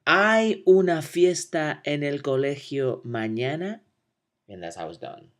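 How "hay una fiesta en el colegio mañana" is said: "Hay una fiesta en el colegio mañana?" with a rising end; question tune. Shown as a demonstration. Teacher to class, modeling rising question intonation for repetition. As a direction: lift "mañana" up as a yes-no question. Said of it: "Repeat after me".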